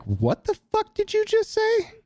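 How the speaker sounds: high-pitched incredulous demand; incredulous outrage. Retold or imitated. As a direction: fire it back in high-pitched disbelief. Acted angry reply to an insult.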